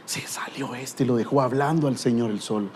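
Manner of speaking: hushed, breathy whisper aside. Real, not acted. Preacher confiding to congregation, whispering. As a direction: drop to a whisper as if sharing a secret. Aside after apologizing for swearing.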